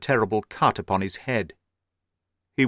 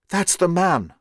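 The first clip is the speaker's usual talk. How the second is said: a higher urgent gasp of recognition; gasped alarm; 7 semitones higher than usual. A narrator's reading. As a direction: point him out in alarm. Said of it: "I gasped".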